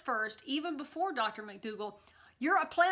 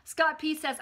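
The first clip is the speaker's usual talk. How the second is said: ordinary talk, nothing marked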